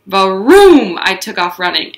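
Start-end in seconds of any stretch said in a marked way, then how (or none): 0.1-1.0 slow, loud stretched varoom. Acted. Narrator revving up Junie's run, loud. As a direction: draw out the engine sound. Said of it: "sound effect in narration".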